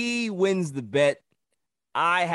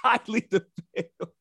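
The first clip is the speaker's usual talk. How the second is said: laughing echo; 6 semitones higher than usual. Real, not acted. Laughing echo of friend's jab.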